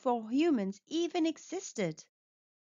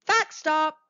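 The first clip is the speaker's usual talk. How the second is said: higher and louder announcement; excited callout; 5 semitones higher, 4 dB louder than usual. Acted. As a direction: call it out like a fun game signal. Announcing a fact break heading.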